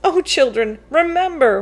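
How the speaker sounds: ordinary delivery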